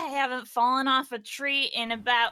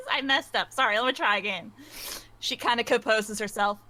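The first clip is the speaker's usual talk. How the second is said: normal talking voice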